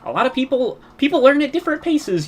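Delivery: high, loud mocking teacher; sneering high-pitched teacher impression. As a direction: parrot the teacher in a high sing-song. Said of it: "she was like".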